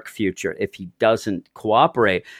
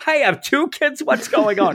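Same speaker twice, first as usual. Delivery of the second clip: very high, astonished cry; hamming up stunned surprise; mock shock; 12 semitones higher than usual. Deadpool learning of two kids, mock shock. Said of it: "He's like".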